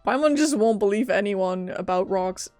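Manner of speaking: ordinary delivery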